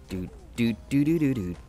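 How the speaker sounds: ordinary delivery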